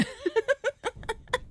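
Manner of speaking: high, breaking giggle; real high-pitched relieved laugh; nervous laughter. Real, not acted. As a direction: laugh out the tension in high bursts. Relieved laughter after survival.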